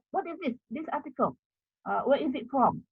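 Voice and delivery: normal talking voice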